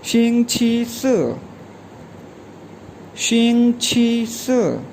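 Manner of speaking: शिंग छी स drawn out slowly with a falling tune; teacher repeating शिंग छी स for students; slow model word. Shown as a demonstration. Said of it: "ये प्रोनाउंस होगा".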